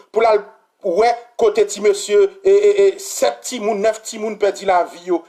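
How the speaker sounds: ordinary talk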